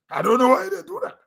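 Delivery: ordinary talk, nothing marked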